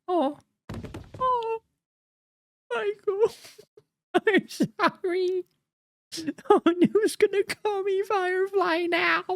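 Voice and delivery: high, drawn-out wail of grief; hammed-up mourning in a very high voice; mock wailing. Mock mourning the stabbed character.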